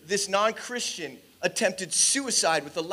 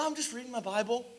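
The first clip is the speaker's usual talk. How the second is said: soft, quick imitated answer; quiet offhand reply; 3 semitones higher, 11 dB quieter, faster than usual. Boy answering friends at the lunch table, quiet offhand reply. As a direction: toss it off softly, as if it's nothing.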